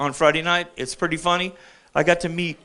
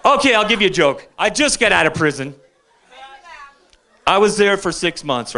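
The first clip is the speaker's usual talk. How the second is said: ordinary talk, nothing marked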